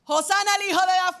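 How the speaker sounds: loud, high, fast hosanna cry; crowd's exclamation. Acted. Preacher voicing the Jerusalem crowd, loud jubilant cry.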